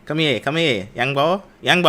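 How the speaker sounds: playful Philly impression; mimicked Philly accent. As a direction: call out in mock Philly accent. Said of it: "This is a Philly accent".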